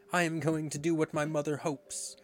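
put-on high, mildly hysterical boy; hysterical cry. A narrator's reading. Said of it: "Toodles cried out hysterically".

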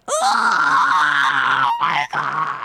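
slow, drawn-out horrified oh my God. Retold or imitated. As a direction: stretch it out in dawning horror. Realizing the tattoo mistake.

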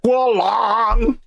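high, slow, put-on blurt; goofy exclamation. Co-host cracking a joke about the baby, a slow comic blurt. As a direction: blurt the name out like a punchline.

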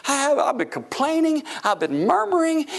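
high-pitched acted disbelief; amazed confession. Amazed, guilty believer's reaction acted out.

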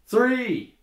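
high, loud call across a jail; yelled number. Acted. Comic telling a joke as an inmate calling to the jail, yelled number. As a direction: holler it out high and hard. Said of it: "someone calls out a number".

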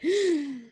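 sharp high gasping breath; imitating her daughter's sudden gasp for air; imitated gasp. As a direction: mimic a child gasping back to life. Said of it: "she would just, like".

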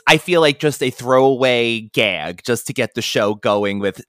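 ordinary talk

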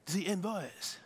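put-on insistent Frenchman, soft French accent. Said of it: "his accent is increasing in intensity".

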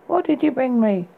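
put-on high, slurring drowsy girl; lightly voiced tipsy woman, words smeared; slurred. A narrator's reading. Rapunzel slurring down at the prince, drowsy. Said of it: "she slurred".

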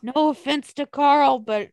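ordinary talk

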